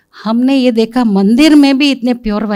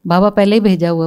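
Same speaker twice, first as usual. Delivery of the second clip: ordinary talk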